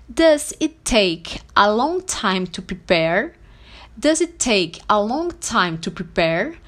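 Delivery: slow, word-by-word English question; model phrase. Shown as a demonstration. Teacher showing how to ask about waiting time, slow and deliberate. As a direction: enunciate slowly, rising on prepare. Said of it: "você pode perguntar assim".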